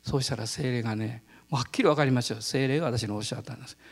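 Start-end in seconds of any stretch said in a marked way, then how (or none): none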